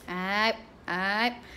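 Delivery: a mild, low, warning "aib, aib"; scolding tone. Shown as a demonstration. Mother demonstrating how she scolds her toddler, mild low warning. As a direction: tell the child off with the sound alone, low. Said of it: "Dia tahu the tone".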